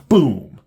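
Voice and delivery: loud boom. Acted. Sound effect of Superman bursting out.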